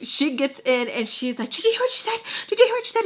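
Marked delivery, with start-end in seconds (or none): 1.5-3.0 very high, squealed mock outrage; shrieky imitated complaint; outraged tattling. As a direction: mimic her tattling to dad, pitched way up. Girl tattling to dad mid fight.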